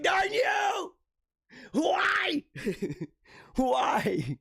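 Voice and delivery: high, cracking cry of despair to the sky; mock anguished yell. Hero who saved the puppy, howling at the sky in mock anguish. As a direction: scream it like a ruined hero cursing fate.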